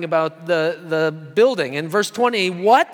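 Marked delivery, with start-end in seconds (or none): 2.5-2.9 a loud, startled What?; shocked exclamation. Retold or imitated. Temple leaders exclaiming at Jesus, loud shocked What. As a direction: blurt it out in disbelief. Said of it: "they exclaimed".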